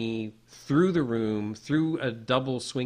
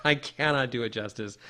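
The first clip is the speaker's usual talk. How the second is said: usual speaking voice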